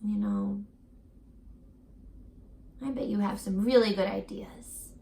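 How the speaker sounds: ordinary delivery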